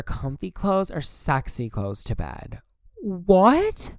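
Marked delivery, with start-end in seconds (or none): 3.0-3.9 sudden high, loud disbelieving what; surprised what. Real, not acted. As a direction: jolt up in disbelief at the question. Reacting to a silly question.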